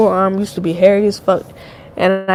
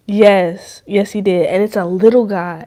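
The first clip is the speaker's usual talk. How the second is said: ordinary talk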